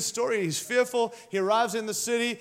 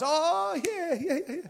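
higher, eager Jesse suddenly remembering; a father blurting out yes, yes; 7 semitones higher than usual. Acted. Jesse voiced in retold story.

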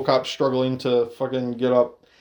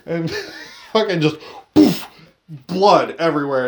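ordinary talk

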